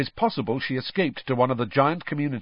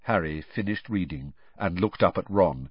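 ordinary delivery, nothing marked

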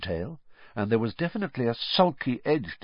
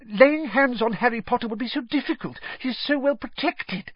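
high, squeaky relieved servant; a cringing servant breathing out in relief; whiny, relieved Wormtail voice; 7 dB louder, faster than usual. Acted. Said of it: "sounding thoroughly relieved now".

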